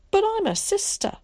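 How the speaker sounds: as if she can't believe she was left out; shocked disbelief. A narrator's reading. Sister blurts to Zack in shocked disbelief.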